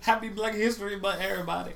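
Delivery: high, loud whoop to the room; excited cheer. Real, not acted. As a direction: cheer it out to everybody. Cheering announcement.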